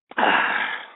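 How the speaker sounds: heavy sigh after a drink. Real, not acted. Host takes a drink on air.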